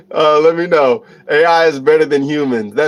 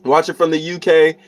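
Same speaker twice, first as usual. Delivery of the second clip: usual speaking voice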